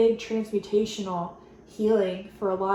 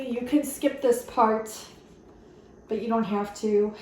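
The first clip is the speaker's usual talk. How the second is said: ordinary talk, nothing marked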